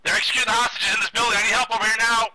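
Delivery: high, fast, urgent call for help; urgent shouted callout. Real, not acted. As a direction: yell for backup, pitch rising. Urgent call for help mid-firefight.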